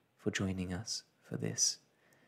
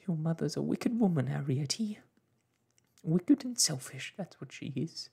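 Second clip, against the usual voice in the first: higher, upset mother's quaver; louder, tearful self-reproach; trembling voice; 7 semitones higher, 4 dB louder than usual. A narrator's reading. Mother calls herself wicked to daughter, trembling. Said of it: "Homily's voice began to tremble".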